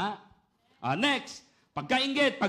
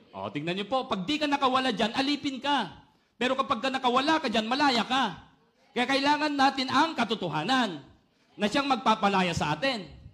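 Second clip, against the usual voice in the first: louder, higher, driving the point home; full-force preaching; 5 semitones higher, 5 dB louder than usual. Acted. Preacher urging the congregation toward freedom, full force. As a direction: preach it out to the whole church.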